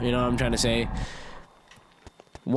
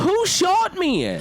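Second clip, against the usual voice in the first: high, drawn-out outraged yelp; indignant cry; 3 dB louder, much slower than usual. Real, not acted. Gamer just shot by an unseen enemy, indignant cry.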